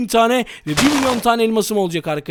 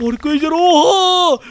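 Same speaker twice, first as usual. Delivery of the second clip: amazed cry; 7 semitones higher, 4 dB louder, slower than usual. Real, not acted. Amazed at pile of money.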